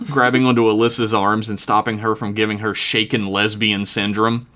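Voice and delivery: normal talking voice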